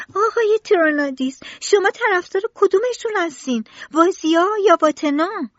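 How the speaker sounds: put-on high, loud boy's voice calling out; loud child's question. A narrator's reading. Tistou asking Tronadis over the factory noise, high and loud. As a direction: shout the question up at the grown-up. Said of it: "با صدای بلند گفت".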